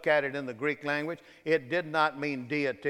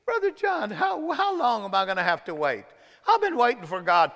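mock complaint; 7 semitones higher, 4 dB louder than usual. Voices an impatient believer's complaint.

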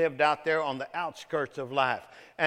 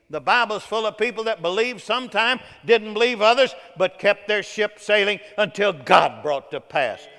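loud, raised sermon delivery; full-force preaching; 5 semitones higher, 8 dB louder than usual. Acted. Preacher telling the congregation about doubting Bible figures, full-force preaching. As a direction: preach it hard without letting up.

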